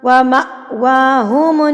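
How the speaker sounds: slow व मअवाहुमुन with an abrupt catch after "ma"; jerked hamza. Shown as a demonstration. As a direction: jolt to a stop at the hamza in व मअवाहुमुन. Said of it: "झटका दे के पढ़ना है".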